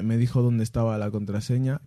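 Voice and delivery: ordinary talk, nothing marked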